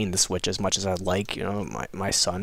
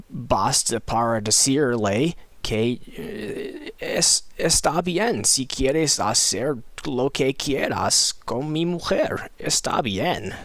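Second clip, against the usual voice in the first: slow, drawn-out Spanish at higher pitch; halting learner's Spanish; 4 semitones higher, slower than usual. Shown as a demonstration. Showing off Spanish practiced with wife's boyfriend, halting. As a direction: stretch out each Spanish phrase like a learner.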